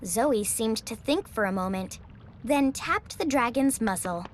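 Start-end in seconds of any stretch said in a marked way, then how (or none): none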